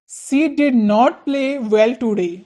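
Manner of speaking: each word drawn out slowly; slow dictation. Shown as a demonstration. Teacher slowly models the negative sentence. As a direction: spell it out with your voice. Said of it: "example sentence".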